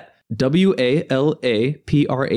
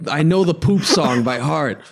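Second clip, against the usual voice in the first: ordinary delivery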